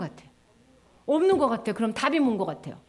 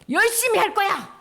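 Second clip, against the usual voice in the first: higher, louder bright "열심히 할 거야"; eager resolve; 6 semitones higher, 4 dB louder than usual. Shown as a demonstration. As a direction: flip to keen and cheerful. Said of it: "한번 해보세요".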